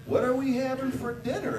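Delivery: husband calling to his wife at the stove. Retold or imitated. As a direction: call out to her back again.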